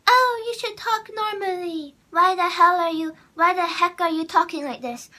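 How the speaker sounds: high, loud whiny imitation; mocking online commenters. Vlogger imitating commenters who scold her voice, mocking. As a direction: mimic a scolding commenter, exaggerated. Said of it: "I get so many comments".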